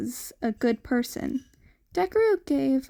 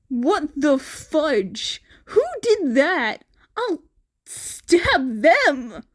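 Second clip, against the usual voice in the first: high, louder protective anger; indignant outburst; 4 semitones higher, 6 dB louder, slower than usual. Acted. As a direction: yell it like you'd fight for him.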